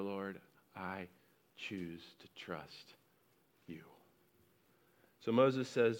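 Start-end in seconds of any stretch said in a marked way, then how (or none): 0.0-3.8 soft, slow prayer of trust; hushed prayer. Pretend. Believer surrendering to God, slow and very soft. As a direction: let each word sink, almost whispered. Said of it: "Sometimes you need to go".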